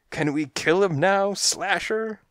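high, pleading cat thug; eager henchcat. A narrator's reading. Thug cat begs boss to kill, eager. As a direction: beg high, rising on the boss's name.

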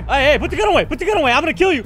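yelling high and loud at a gunman; panicked shouting. Real, not acted. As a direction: scream at him to drop the gun. Gunman confronting him in game.

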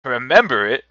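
loud fervent cry; with fervor. A narrator's reading. Scrooge answers the spirit, fervent loud cry. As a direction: insist with passion. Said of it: "with fervor".

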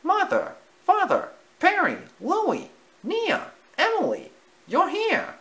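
very high, delighted cry; surprised mouse voice. Acted. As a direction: gasp out each name with joy. Timothy sees family, surprised.

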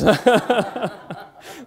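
loud high laugh at own joke; burst of laughter. Real, not acted.

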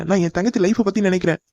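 normal talking voice

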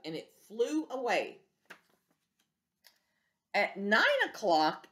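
usual speaking voice

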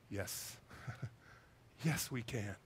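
low, soft, moved answer; quiet, tender assent after a pause; hushed yes. Retold or imitated. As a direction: drop right down and agree quietly. Answering a moving plea, retold.

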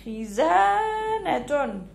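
خزانة with the "za" held very long; stretched long vowel. Shown as a demonstration. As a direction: draw out the زَا of خزانة slowly. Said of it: "زدنا المد بالألف".

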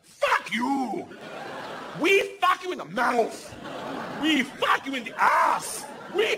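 put-on high, slow accented threat; hammed-up gangster menace, drawn out; Igor.

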